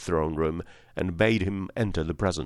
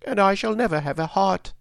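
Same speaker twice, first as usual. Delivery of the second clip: very high character voice; Tin Woodman; 12 semitones higher, 3 dB louder than usual. A narrator's reading. Tin Woodman lamenting to his friends, high-pitched. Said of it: "spoke the Tin Woodman".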